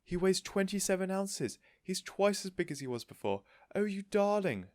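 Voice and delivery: high, loud squeals of joy; thrilled lady waving the tortoise overhead; excited shouting. A narrator's reading. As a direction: shout it out, overjoyed. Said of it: "waving it about her head and shouting".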